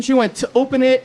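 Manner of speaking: ordinary talk, nothing marked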